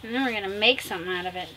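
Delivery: ordinary delivery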